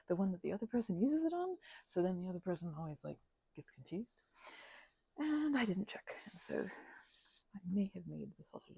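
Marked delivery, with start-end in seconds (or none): none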